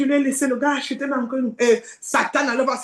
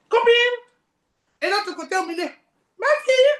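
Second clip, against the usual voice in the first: calling out; 10 semitones higher, 4 dB louder than usual. Acted. Voices calling from each side.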